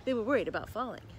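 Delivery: plain reading voice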